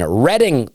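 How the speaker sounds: "Reading" pronounced RED-ing; corrected pronunciation. Real, not acted. Comedian plugging a show after being corrected, "Reading" said as RED-ing. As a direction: say "Reading" to rhyme with wedding. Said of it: "received about my pronunciations".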